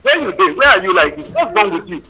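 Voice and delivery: usual speaking voice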